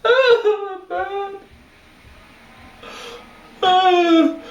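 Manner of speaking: high pained moans, cracking; pained groaning. Real, not acted. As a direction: groan through burning pain. Nose burning from chili powder.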